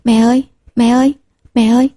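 lightly voiced eager children calling; a slightly lifted childlike call; children clamoring. A narrator's reading. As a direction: call out for mother like jostling little kids. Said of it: "sáu đứa nhóc chen chúc nhau gọi".

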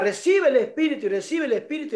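high mimicked faith-healer chant; fervent preacher. Pastor moving on to others, mimicked fervent invocation. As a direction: repeat the blessing like a pastor on a roll.

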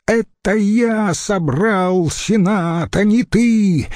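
high, drawn-out outraged wail; indignant yell. A narrator's reading. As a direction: assert authority in a high, stretched cry. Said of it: "завопил Марцел-старший". (возмущённый вопль)

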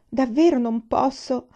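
insistent refusal. A narrator's reading. Jailer refusing to free the student, anxious and insistent. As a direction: stress davvero, pleading that he cannot. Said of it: "disse il carceriere".

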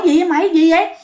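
alarmed neighbour. Retold or imitated. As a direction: rush in asking what happened. Neighbour rushing over at scream.

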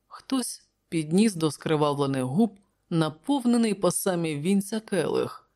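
ordinary delivery

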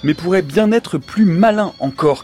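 measured announcer read over music; dramatic documentary-style opening read; radio intro read. Acted. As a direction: set up the theme like a documentary opener.

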